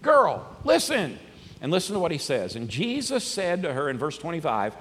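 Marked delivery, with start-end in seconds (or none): 0.0-1.2 high, loud, insistent plea; loud, drawn-out urging with pitch swoops; urgent exhortation. Retold or imitated. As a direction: shake some sense into her. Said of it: "kinda grabs her by the shoulders".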